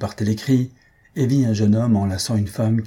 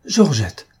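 higher, falling call of a name; startled cry; 5 semitones higher, 4 dB louder than usual. A narrator's reading. Bengali recognises the young woman he rescued, startled cry. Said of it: "s'écria-t-il".

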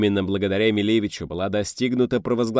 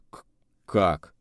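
stunned stammer. A narrator's reading. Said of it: "оторопел военпред".

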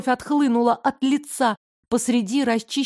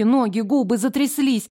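ordinary delivery

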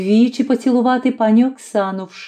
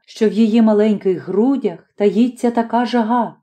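ordinary delivery

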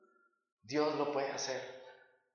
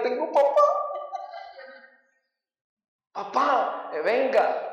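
put-on higher, childlike call for papa; inner child voice; 7 semitones higher, 3 dB louder, slower than usual. The inner child calling his father, childlike and higher. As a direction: sound like a small boy calling his father.

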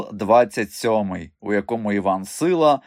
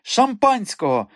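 high, slow, demanding cry; imperious madam, high and drawn out; calling out; 10 semitones higher than usual. A narrator's reading. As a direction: call out a demand, stretching the words. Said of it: "гукнула мадам Бухенбах імпресаріо".

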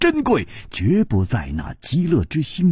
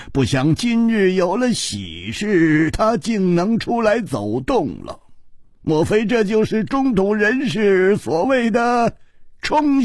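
high-pitched delighted king, chuckling into the first words; laughing king; 7 semitones higher than usual. A narrator's reading. King rejoices that his sick queen can walk, laughing and delighted. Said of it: "秋瓷王笑着说".

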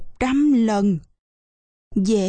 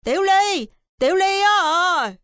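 high-pitched call to a neighbour; high, carrying playmate's call; calling across; 8 semitones higher than usual. Acted. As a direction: call out the name to someone next door. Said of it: "gọi vọng sang".

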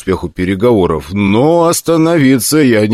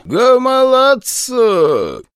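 much higher, slow alien orc growl; rasping orc; much slower than usual. Acted. Captured orc rasps in his own tongue, struggling. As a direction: wheeze out strange orc words while struggling. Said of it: "хрипел орк".

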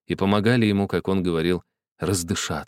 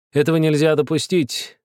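icy voice; 7 semitones higher, 3 dB louder than usual. A narrator's reading. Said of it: "сказал ледяным голосом Бабель".

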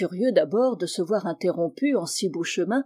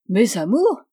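loud, affronted echo; offended cry; 2 semitones higher, 6 dB louder than usual. A narrator's reading. Spinster asked about her loves, offended cry. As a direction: throw it back, scandalised. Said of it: "s'écria la demoiselle offusquée".

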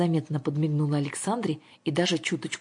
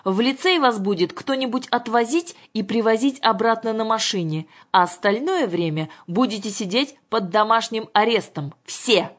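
raised, higher insistent voice; stern ruling; 6 semitones higher, 4 dB louder than usual. A narrator's reading. Father confirms house arrest to daughters, stern. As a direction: lay it down and close discussion.

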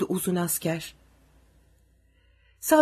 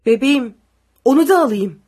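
anxious plea; 3 semitones higher, 9 dB louder than usual. A narrator's reading. Father begs to take baby.

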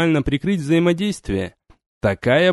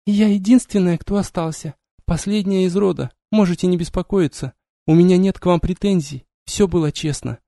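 higher, composed female voice; calm, proud young woman; 4 semitones higher than usual. Acted. Vampiress speaks to the giant.

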